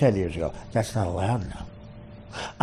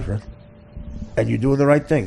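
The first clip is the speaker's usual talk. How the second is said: usual speaking voice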